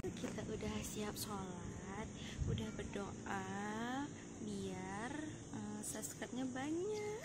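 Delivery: suara pelan hampir berbisik. Real, not acted. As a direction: bicara lirih di tempat ibadah. Berbisik setelah siap sholat. (berbisik pelan)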